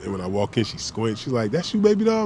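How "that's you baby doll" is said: put-on high, squinting old-lady voice; imitating grandma. Grandma squints at her grandson walking in, high voice. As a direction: call out like a grandma who can barely see.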